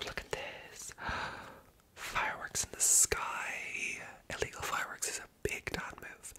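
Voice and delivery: ordinary delivery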